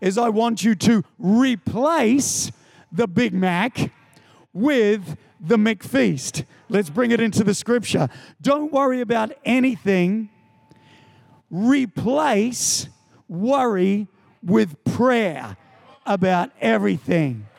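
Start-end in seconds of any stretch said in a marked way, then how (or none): 0.0-6.4 each word spelled out slowly; patient, over-careful explanation; slow over-explaining customer. Retold or imitated. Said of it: "the man would say to my daughter". 11.5-17.4 drawn out word by word; key line of the sermon, dragged out; slow emphatic. Shown as a demonstration. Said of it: "emphatic teaching of key word".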